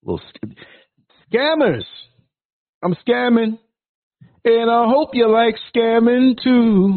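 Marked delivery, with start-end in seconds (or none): 1.3-7.0 high, slow mocking singsong; mocking high teasing voice. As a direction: tease them in a high voice.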